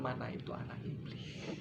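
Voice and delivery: hushed whisper; dramatic whisper. Acted. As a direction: drop to a whisper for the punchline. Dramatic aside in sermon. (bisikan dramatis)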